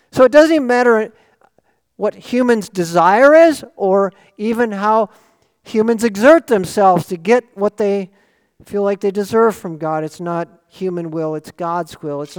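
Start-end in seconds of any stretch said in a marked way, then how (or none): 0.1-10.0 louder, rising sermon delivery; forceful preaching. Acted.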